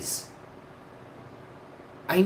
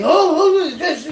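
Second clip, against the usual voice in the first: laughing panic; 13 semitones higher, 9 dB louder than usual. Retold or imitated. Retelling panic in the smoke.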